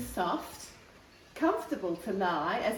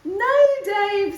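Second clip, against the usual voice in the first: put-on higher, louder child calling the dog; girl calling; 11 semitones higher, 10 dB louder than usual. A narrator's reading. The daughter calls the jumping dog, higher and louder. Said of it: "said Kate".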